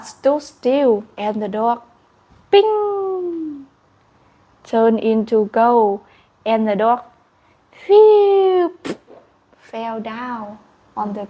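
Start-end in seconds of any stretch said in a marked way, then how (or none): 2.5-3.6 high ping sound effect; a bright chime as the magic strikes; a light high ping. Acted. Dog turns to gold. 7.7-8.7 loud high phew sound effect; a quick swooping whoosh; a louder, higher whoosh noise. Acted. Dog falls down.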